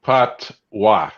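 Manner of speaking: PATOIS split into PAHT and WAH; patient, spaced-out correction of Patois; slow correction. Shown as a demonstration. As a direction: say Patois slowly, each part apart. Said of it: "I gotta speak slow".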